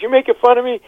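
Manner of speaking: very high, loud put-on screaming; hammed-up outraged scream. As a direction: yell it like an insulted bleeding man. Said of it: "he starts screaming at me".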